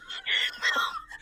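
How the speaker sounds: shrieking high laugh near tears; high squealing laugh. Real, not acted. Wife cracking up at the word mixup.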